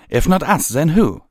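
high, loud, heated demand; indignant challenge. Acted. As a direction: throw the challenge back forcefully. Pyre Valor's challenge in a quarrel.

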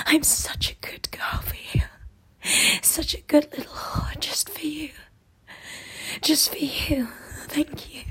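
soft, submissive whisper; hushed, pleading whisper. Acted. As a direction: whisper close to the mic. Long whispered pleading run.

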